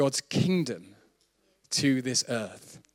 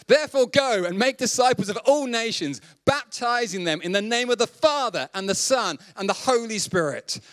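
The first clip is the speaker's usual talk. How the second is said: raised, ringing proclamation of the verse, higher; scripture reading; 9 semitones higher than usual. Acted. Reads Great Commission aloud.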